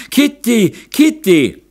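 high, loud wail of a name; wailing cry. A narrator's reading. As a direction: cry out for someone, distressed. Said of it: "hörte man den Jammerschrei der Glesberg". (hoher, lauter Jammerschrei)

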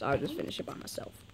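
soft whisper to himself; whispered, half to himself; whispered aside. Real, not acted. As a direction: drop to a whisper. Trailing off to himself.

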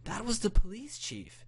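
high-pitched, confused grandmother; scared grandma. A narrator's reading. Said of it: "Her face was scared but confused".

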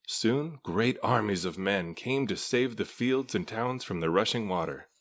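ordinary delivery

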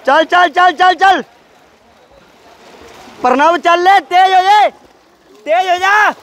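high shouted encouragement; cheering on. Real, not acted. Cheering Pranav on from the poolside, loud high shouts.

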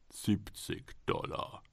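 whispered, slow price offer; hushed bid. Acted. Golem's buyer whispering his falling bid. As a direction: breathe the number out slowly. Golem's man bids quietly.

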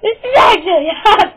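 high, loud, overjoyed yell; excited shout. Acted. Gamer hyping up the match, excited shouting. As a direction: shout it out in amazed triumph.

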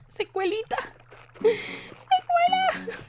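stretched high wail, mock grief; hammed-up lament for the lost Secuela. Lamenting the missing Secuela, stretched high mock wail.

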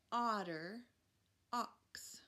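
Otter and ox with the opening ah drawn out; stretched first sound. Shown as a demonstration. Teacher stretching the ah sound in Otter, ox for kids. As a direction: lean on the ah at the start of Otter and ox. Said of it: "What if I go like this".

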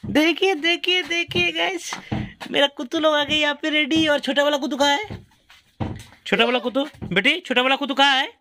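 high-pitched, delighted cooing; excited puppy talk. Real, not acted. Father presents the puppies to viewers and child, high excited cooing.

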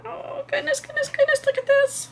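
very high squeal of delight; very high gushing delight; excited squeal. Real, not acted. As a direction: squeal over the pretty blocks. Gushing over the quilt blocks.

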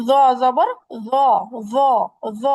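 জ said with rounded lips, tongue on the upper teeth; thick emphatic za sound; heavy za. Shown as a demonstration. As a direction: round the mouth for a full জ. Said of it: "মুখটাকে গোল করতে হচ্ছে".

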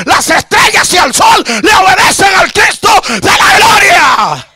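high, loud revival shout; fervent pulpit cry to the congregation; full-force preaching. Real, not acted. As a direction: preach it out loud, stretching the big phrases.

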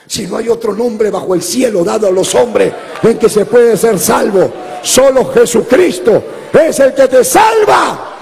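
building to a full preaching roar; preaching shout. Real, not acted. Pastor preaching at full force. (gritando con fuerza de predicador)

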